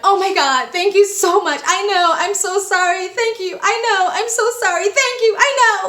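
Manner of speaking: high, gushing mock gratitude; playing along. Traveler thanking the bribing official, gushing fake gratitude. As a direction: overdo the humble thanks. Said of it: "I played along".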